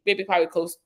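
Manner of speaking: normal talking voice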